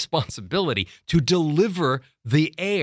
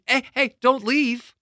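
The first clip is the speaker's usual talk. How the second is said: higher-pitched urgent call after someone; 10 semitones higher than usual. Acted. Customer to departing delivery driver, calling out. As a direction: call out anxiously to stop the driver. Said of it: "Should I run down the steps and say".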